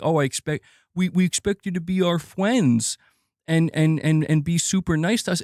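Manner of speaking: lightly mocking gamer whine; mock whiny fan. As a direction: parody the entitled fan asking to be coddled. Mocking entitled fans' demands.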